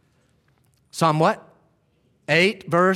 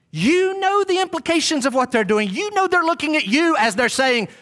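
pointed accusing paraphrase; 9 semitones higher than usual. Pretend.

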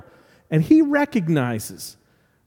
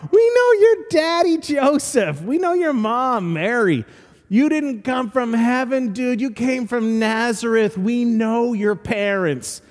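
scoffing heckler; 4 semitones higher than usual. Retold or imitated. People at the back yelling at Jesus, high mocking jeer. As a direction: sneer him down from the back. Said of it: "they yelled".

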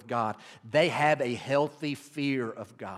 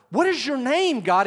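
voicing Moses; 10 semitones higher, 7 dB louder than usual. Moses asking God's name.

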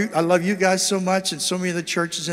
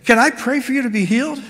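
Abby's gentle question; 5 semitones higher, 3 dB louder than usual. Retold or imitated. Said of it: "she said".

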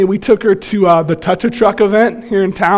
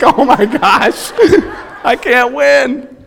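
exasperated laugh; 7 semitones higher, slower than usual. Retold or imitated. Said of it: "I'm like".

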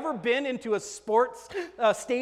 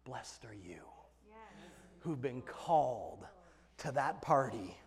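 low, soft, reverent blessing; hushed solemn; 8 semitones lower, 8 dB quieter than usual. Acted. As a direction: lean in and say it gently.

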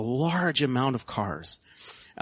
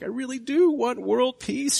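high-pitched, drawn-out tearful gush; mock tearful; 11 semitones higher than usual. Pageant contestant pleading for world peace, mock tearful. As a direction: imitate a pageant contestant tearing up. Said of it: "she kind of starts crying".

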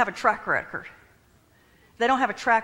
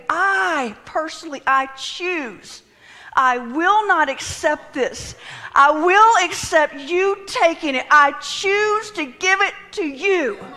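loud, raised, slow preaching delivery; forceful declaration; 6 semitones higher, 6 dB louder, slower than usual. Acted. Preacher voicing the choice to yield, forceful declaration. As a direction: proclaim each phrase with resolve.

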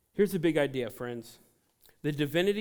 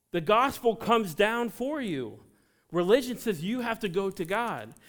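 preaching; 6 semitones higher, 4 dB louder than usual. Real, not acted. Preaching builds.

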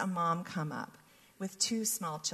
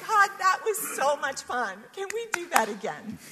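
higher, louder, thrilled; giddy delight; 8 semitones higher, 7 dB louder than usual. Retold or imitated. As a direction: gush with delight. Joyful retold reaction.

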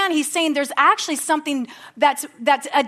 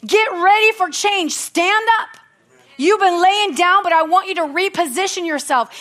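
raised, ringing preacher's call to act; full-force preaching; 5 semitones higher than usual. Acted. Pastor urging the congregation to act, full-force preaching. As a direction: preach it up to the whole church.

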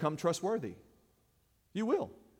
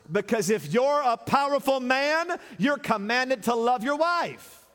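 loud, high, driving sermon cadence; full-force preaching; 5 semitones higher, 4 dB louder than usual. Acted. Preacher driving home love to the church. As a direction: preach it hard to the congregation.

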